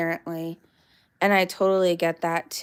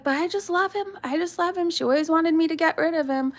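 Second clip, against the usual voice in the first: lightly voiced vet, pitched up; a doting owner gushing about his dog; high, fond gushing; quoting the vet; 7 semitones higher, faster than usual. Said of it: "And he's like".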